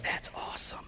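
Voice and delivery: soft unvoiced whisper, barely there; whispered reply. Girl whispering her happy reply to him on the phone. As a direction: breathe it like a secret.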